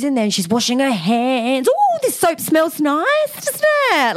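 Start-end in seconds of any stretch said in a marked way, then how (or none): 1.7-4.2 high, slow, sing-song gushing; an overly cheerful intruder, much higher; chirpy flatmate. Retold or imitated. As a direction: coo over the soap like an oblivious guest. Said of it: "Like this".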